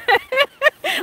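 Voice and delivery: high laugh with a catch; laughing through happy tears; high, emotional laugh; teary laugh. Real, not acted. Everyone crying in chat.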